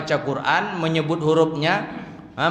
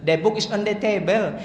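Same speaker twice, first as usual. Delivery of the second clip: mock Rimbo Panjang accent, pitched higher; Rimbo Panjang-style English; 5 semitones higher than usual. Shown as a demonstration. Teacher imitates English Rimbo Panjang style, higher mock accent. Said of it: "Gaya Rimbo Panjang".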